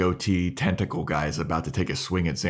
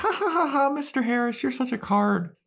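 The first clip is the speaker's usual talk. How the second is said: much higher mock Buffy laugh; fake polite laugh. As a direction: play Buffy laughing it off falsely.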